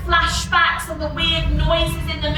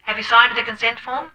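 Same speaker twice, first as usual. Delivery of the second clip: a quick, lower scripted intake voice; brisk counsellor; 6 semitones lower, much faster than usual. Acted. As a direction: rush through the rights like a form. Counsellor reads patient's rights.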